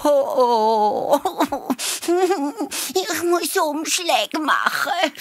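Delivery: very high, moaning hare with fake bellyache; hammed-up pitiful wail, halting with long "oh"s; feigned whining. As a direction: whimper and groan, putting on a tummy ache. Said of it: "den Bauch gegeben und gejammert".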